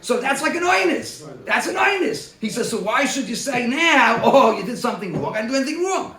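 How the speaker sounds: excited Talmud argument, voicing the man's defense. Real, not acted. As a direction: build up and push the argument. Excited argument, quoting the man.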